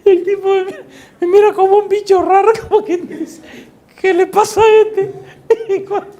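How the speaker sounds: high, loud laughing voice; helpless laughter. Real, not acted. Preacher retelling the possessed man's puzzled stare, laughing hard. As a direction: squeal the words through real laughter. Cracks up telling the story.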